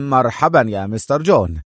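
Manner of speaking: usual speaking voice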